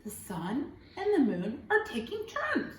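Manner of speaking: usual speaking voice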